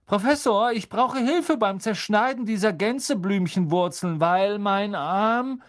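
high, loud, whiny schoolboy plea; milk the sore arm for pity; whiny Malfoy call. A narrator's reading. Malfoy begs the professor for help, high and whiny. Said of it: "rief Malfoy".

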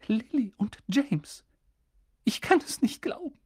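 reeling at the news; shocked McGonagall. A narrator's reading.

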